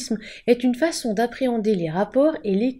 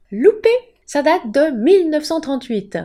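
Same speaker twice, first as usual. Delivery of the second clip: triumphant loud gotcha; 6 semitones higher, 6 dB louder than usual. Acted. Corrige l'idée reçue en criant.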